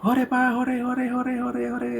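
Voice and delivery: put-on high, excited kid's cheer; lightly high child cheering. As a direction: chant it like a thrilled little child.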